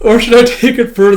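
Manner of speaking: fast, high, laughing through it. Real, not acted. Reader still laughing while pitching a sillier take. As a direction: giggle the words out quickly.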